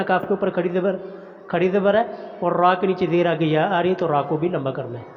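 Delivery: ordinary talk, nothing marked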